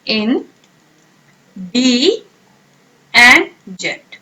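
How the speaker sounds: in, di, jent, split apart with pauses; slow, syllable by syllable. Shown as a demonstration. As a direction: break "In डी एंड जेंट" into slow separate syllables. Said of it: "अब आप इसे pronounce कैसे".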